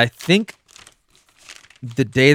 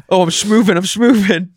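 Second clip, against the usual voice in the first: goofy voice; 8 semitones higher, 5 dB louder than usual. Retold or imitated. Goofy self-quote while fighting.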